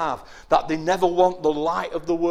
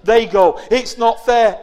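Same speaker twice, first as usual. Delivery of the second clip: envious whining; 4 semitones higher, 7 dB louder than usual. Acted. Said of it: "they say".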